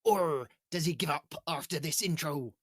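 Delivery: hearty pirate captain. Acted. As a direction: growl it like a sea captain. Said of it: "show them your pirate voice".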